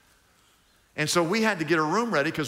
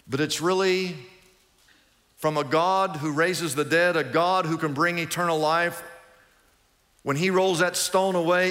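ordinary talk

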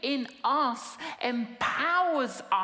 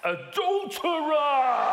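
accusing shout; 3 semitones higher, 4 dB louder, slower than usual. Retold or imitated. Accusing the adulteress.

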